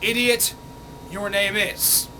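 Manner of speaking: sharp scornful snap; disgusted idiot. Real, not acted. Reacting to the comment, scornful. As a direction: spit the insult out.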